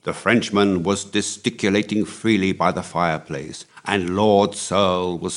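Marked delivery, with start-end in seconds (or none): none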